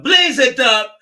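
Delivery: loud, high hype shout; excited Rasta shout. Pretend. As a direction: fire up the crowd with a playful yell. Hyped Rasta shout about Jamaica.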